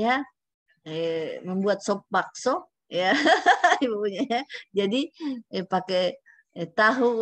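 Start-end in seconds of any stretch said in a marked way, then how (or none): 3.2-3.8 laugh. Real, not acted. Teacher joking about a soup recipe to students, laughing out loud. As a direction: burst of high, loud laughter.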